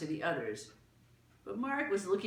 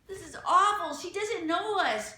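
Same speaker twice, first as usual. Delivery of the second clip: high, loud distressed cry; 11 semitones higher, 7 dB louder than usual. A narrator's reading. Martha crying out at her mother, high and loud. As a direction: cry out in dismay. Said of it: "cried Martha".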